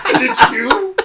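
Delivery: laughing squeak. Acted.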